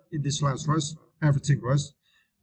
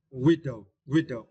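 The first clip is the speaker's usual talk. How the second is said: widow with stress on wi, first syllable. Shown as a demonstration. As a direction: say widow as WI-dow. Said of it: "you need to stress the first syllable".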